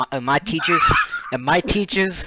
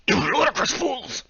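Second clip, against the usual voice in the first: a higher, hammed-up old wizard scolding; mock angry Dumbledore; 9 semitones higher, 3 dB quieter than usual. Headmaster scolding two students, mock angry. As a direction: sneer it like a cranky headmaster. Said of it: "He kept shouting at us angrily".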